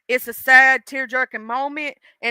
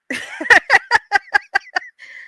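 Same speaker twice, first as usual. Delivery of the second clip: giggling fit, much higher; high embarrassed laugh; 9 semitones higher, 4 dB louder than usual. Real, not acted. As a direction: burst of high laughter at herself. Can't remember movie title.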